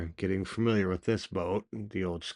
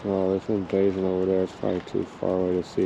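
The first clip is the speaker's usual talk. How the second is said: ordinary delivery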